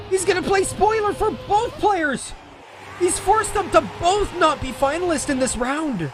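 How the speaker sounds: excited commentary. Real, not acted. Commentator reacts to twist finish.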